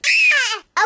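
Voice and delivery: high, short yelp; acted childish squeal; frustrated cry. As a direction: cry out after the taunt. Cries out as Bertha leaves.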